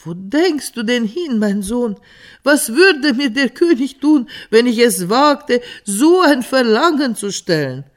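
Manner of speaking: höher und lauter, erschrocken abwehrend; erschrockener Vater. A narrator's reading. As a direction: entsetzt den Sohn zurückhalten, immer lauter. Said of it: "erschrak über diese Kühnheit so sehr". (Vater erschrickt über die Kühnheit seines Sohnes, ängstlich laut)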